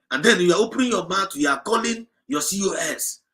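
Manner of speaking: normal talking voice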